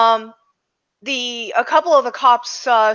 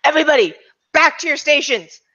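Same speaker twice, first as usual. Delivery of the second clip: raised, commanding police chief; yelled order; 4 semitones higher, 4 dB louder than usual. Acted. Police chief ordering everyone back to stations, raised voice. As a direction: call out orders, a mild acted yell. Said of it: "yells at everyone".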